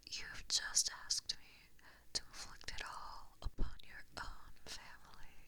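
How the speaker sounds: distant, hollow, otherworldly whisper; eerie magic whisper. Acted. Fox spirit casting a spell into the human's mind, eerie whisper. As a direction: whisper as if inside their skull.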